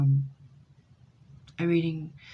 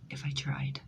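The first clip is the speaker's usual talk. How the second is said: breathy whispered tail; whispered aside; 3 semitones lower, 8 dB quieter than usual. Real, not acted. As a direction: drop to a whisper. Trailing aside.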